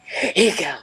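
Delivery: low, slow energy dealer; pushy moon. Pretend. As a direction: tempt them low and slow. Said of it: "super full moon is like".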